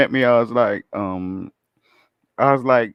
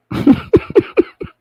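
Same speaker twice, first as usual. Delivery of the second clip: high breathy laugh; 7 dB louder than usual. Real, not acted. [name] laughs about angry caller.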